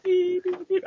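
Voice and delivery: high squeaky beep beep; censor beep imitation. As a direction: mimic the TV bleep, high pitched. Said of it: "you literally just heard".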